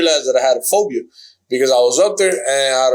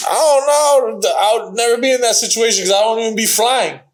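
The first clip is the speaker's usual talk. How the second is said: retold adamant denial; 7 semitones higher than usual. Man to girlfriend's teasing friends, retold firm denial. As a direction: wave the idea away in a raised pitch. Retold refusal of jumping from plane.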